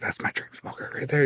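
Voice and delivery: hushed whisper to dad; whispered aside. Retold or imitated. To his old man at the contest, whispering. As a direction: breathe it to him under your breath. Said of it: "and I was like".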